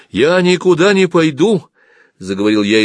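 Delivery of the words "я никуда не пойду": high, loud, slow refusal; alarmed. Acted. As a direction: protest in fear of being left alone. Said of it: "испугавшись возможности опять остаться одному". (испуганный протест)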